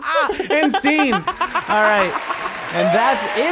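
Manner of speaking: loud, high laughing announcement. Real, not acted. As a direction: break into laughter calling the scene. Ending the improv laughing.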